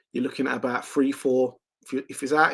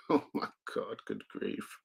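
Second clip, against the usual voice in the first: breathy laughing aside; 5 semitones lower, 13 dB quieter than usual. Real, not acted. Laughing at a viewer comment.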